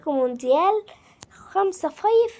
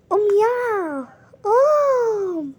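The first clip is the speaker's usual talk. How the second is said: long, high, loud drawn-out call; 7 semitones higher, 5 dB louder, much slower than usual. Real, not acted. Mother calling her son off the bed, long high call.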